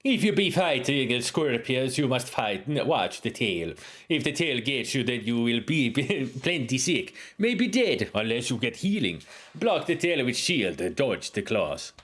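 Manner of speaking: put-on Jamaican-African accent with a laugh breaking through. Uhura explains scorpion fighting in a Jamaican-African accent. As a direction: mix Jamaican and African lilt. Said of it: "how about a Jamaican African accent".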